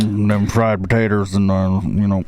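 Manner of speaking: light put-on Mississippi accent. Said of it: "that was my Mississippi accent".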